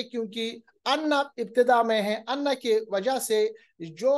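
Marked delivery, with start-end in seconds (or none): none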